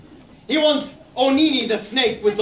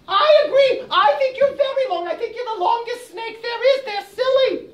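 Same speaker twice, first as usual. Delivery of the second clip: squeaky hedgehog; 14 semitones higher than usual. Acted. Hedgehog argues with Aso, a high indignant squeak. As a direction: protest in a tiny outraged voice.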